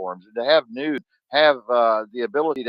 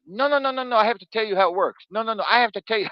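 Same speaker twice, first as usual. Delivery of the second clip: high, excited run of no's, much higher than his own voice; imitating Bruno; 10 semitones higher, faster than usual. Friend Bruno cutting him off, a high insistent imitation. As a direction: pitch way up, rattle off the no's like an insistent Frenchman. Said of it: "And he's like".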